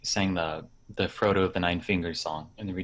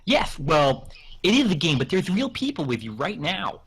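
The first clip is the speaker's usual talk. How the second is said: lightly acted, higher-pitched explainer; interviewer guy; 6 semitones higher than usual. Guy explains the game to Tolkien, lightly acted interviewer voice. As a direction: explain it deferentially to the old author. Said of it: "I'll be the guy".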